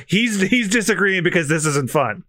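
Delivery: ordinary talk, nothing marked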